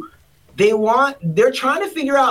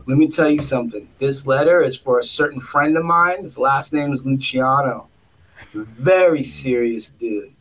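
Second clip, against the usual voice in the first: lowered, menacing mock mobster; tough-guy boss; 7 semitones lower than usual. Boss warning the nervous candidate about Luciano, mock menacing. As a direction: lean in like a wiseguy making a threat. Boss threatening with mob name.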